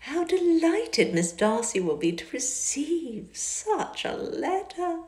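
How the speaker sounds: high, slow, fawning society lady; Miss Bingley's gushing voice. A narrator's reading. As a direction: gush sweetly over his letter.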